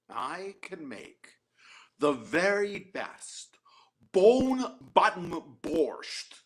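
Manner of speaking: slow, grand, each word savored; slow proud boast. Acted. The beggar grandly promises the shamus a fine borscht. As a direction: build it up like magic.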